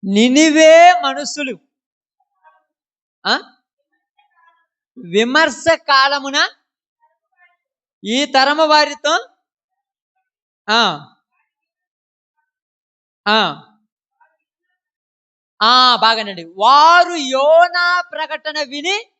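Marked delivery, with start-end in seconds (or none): 0.0-9.3 loud, slow, high-pitched proclamation; scripture declamation. Acted. Preacher reads the verse to the congregation, declaiming loudly. 16.4-19.1 very drawn-out, loud, high proclamation; scripture declamation. Acted. Preacher reads of Jonah's preaching to listeners, declaimed loudly. Reading scripture aloud to congregation.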